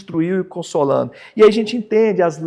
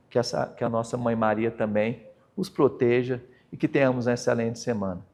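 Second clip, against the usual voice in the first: softer, quieter prayer-like blessing; closing blessing; 9 semitones lower, 6 dB quieter than usual. Acted. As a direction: bless the listeners gently as you close. (bênção final, suave e baixa)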